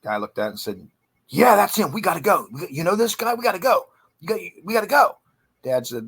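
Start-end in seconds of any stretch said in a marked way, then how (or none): 1.3-5.2 high, urgent imitation of a frightened man; retold panic. Frightened boyfriend begging Dad to leave the house, panicked. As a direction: raise the pitch and push out frantic pleas.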